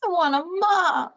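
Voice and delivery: high, loud little lamb call; plaintive high lamb voice. A narrator's reading. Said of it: "said the lamb".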